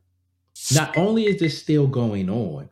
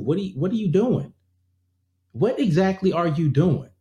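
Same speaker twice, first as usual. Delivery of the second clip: high, indignant questioning; exasperated outrage; 7 semitones higher than usual. Real, not acted. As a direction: demand an answer, voice pushed up high.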